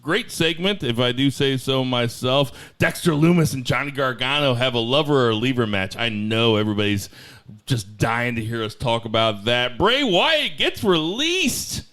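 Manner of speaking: punchy teaser read with swooping pitch; podcast announcer. Acted. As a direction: announce the night's headlines, stretching the big names. Reads the show's opening rundown.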